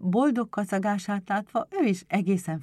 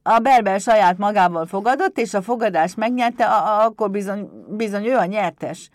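loud, raised imp voice, stumbling once; kobold reasoning firmly; 4 semitones higher, 11 dB louder than usual. A narrator's reading. Pumukli declares Berbel the winner, loud insistent kobold voice. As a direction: argue it out like an earnest little goblin. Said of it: "állapította meg Pumukli következetesen".

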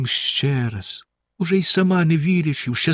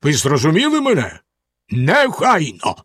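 stern Bluebeard; 2 semitones higher than usual. A narrator's reading. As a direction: let each word fall like a sentence. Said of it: "суворо сказав він".

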